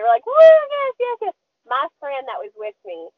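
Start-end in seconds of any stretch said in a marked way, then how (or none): 0.3-1.3 high, loud whooping yes; shrill celebratory woo; excited cheering. Retold or imitated. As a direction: cheer like you just landed a big fish.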